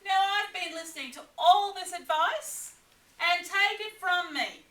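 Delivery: Echidna, confident adviser. Acted. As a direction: lecture them as Echidna.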